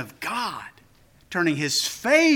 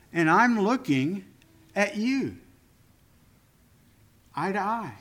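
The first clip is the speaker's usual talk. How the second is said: normal talking voice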